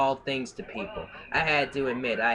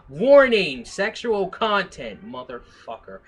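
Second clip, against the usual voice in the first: mock warning voice; 6 semitones higher, 9 dB louder than usual. Reading a dramatic warning label.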